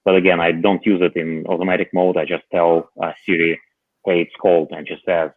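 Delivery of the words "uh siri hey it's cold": ordinary talk, nothing marked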